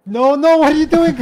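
high, loud alarmed cry; alarmed yell. Real, not acted. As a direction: stop him before disaster. Alarmed yelling at friend.

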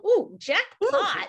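high, slow "Ooh, jackpot"; a gleeful drawn-out jackpot; mock delight. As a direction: savour the lucky find slowly. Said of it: "you just gotta go".

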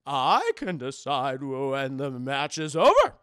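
slow, low, loud decree; old master. Retold or imitated. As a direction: rule on it with drawn-out authority. Master asserts her authority.

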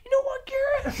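imitated screamed challenge; high, loud mock rage; Trip. Boss threatening his employee by phone, imitated scream. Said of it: "He's screaming at the top of his lungs".

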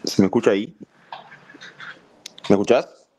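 low, congested voice; real croaky, blocked-up voice. Real, not acted. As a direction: sound stuffed up and hoarse while checking the mic. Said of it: "se te escucha con la voz tomada".

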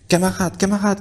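high urgent call; pleading shout. A narrator's reading. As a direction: cry out to the horses. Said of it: "crièrent-ils".

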